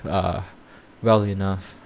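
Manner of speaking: ordinary talk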